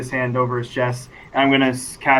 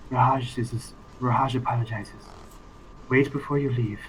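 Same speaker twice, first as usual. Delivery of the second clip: hushed, softly lowered voice; very low whisper; 8 dB quieter than usual. Acted. Rahaj passes Sethanon a private message, very low. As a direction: murmur it low, almost a whisper. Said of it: "whisper very low to Sethanon".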